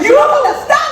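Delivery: high and loud, cry it out; desperate shout. Acted. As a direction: hurl it at her in despair.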